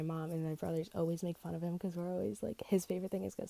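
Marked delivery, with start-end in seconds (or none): none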